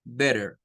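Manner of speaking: "Better" with a soft flapped R in the middle. Shown as a demonstration. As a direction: say "Better" with the T like a light R. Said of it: "como que fuera una R suave". ("Better" con la T como una R suave)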